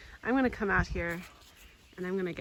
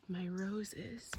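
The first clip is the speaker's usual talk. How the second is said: whisper; 7 dB quieter than usual. Real, not acted. Touring the roses.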